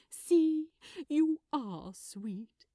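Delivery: bright, loud burst then slow; delighted coo. Acted. Aziraphale beaming at Crowley, delighted. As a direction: tease him fondly, drawing it out.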